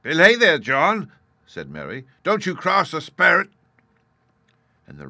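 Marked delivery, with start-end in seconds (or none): 0.0-3.5 high, loud, fearful warning; frightened pirate. A narrator's reading. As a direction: warn him off the spirit. Mary warns fearfully.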